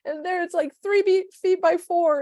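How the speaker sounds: describing the big TV, high bubbly imitation; excited friend. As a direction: brag about the huge screen, bubbly. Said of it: "you're like".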